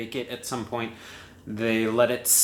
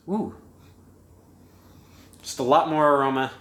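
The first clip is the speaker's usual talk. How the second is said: normal talking voice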